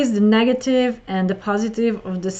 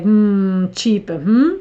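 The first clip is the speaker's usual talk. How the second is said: louder, drawn-out hmm around a questioning "cheaper"; 6 dB louder, slower than usual. Shown as a demonstration. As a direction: hum a doubtful haggle over the price. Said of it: "So you can also say it like".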